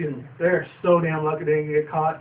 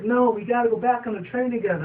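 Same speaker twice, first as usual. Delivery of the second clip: hint of Spike; 7 semitones higher than usual. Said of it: "And Spike's like".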